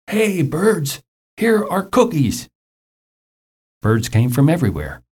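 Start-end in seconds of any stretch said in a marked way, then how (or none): 0.1-2.5 high, drawn-out call at ordinary volume; hammed-up calling-out, pitched way up; storybook shout. A narrator's reading. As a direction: call out as if to far-off birds, without really yelling. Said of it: "shouted in a loud voice".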